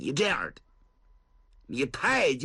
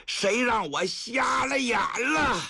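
high, slow self-blaming shout; bitter shout; 10 semitones higher, slower than usual. Acted. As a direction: roar at your own mistake. Old man shouts in regret. (懊悔地大喊)

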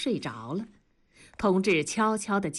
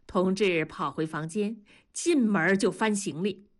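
ordinary delivery, nothing marked

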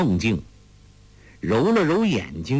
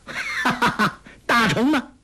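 high-pitched laugh running into the question; laughing taunt; 12 semitones higher than usual. A narrator's reading. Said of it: "欧阳克笑道".

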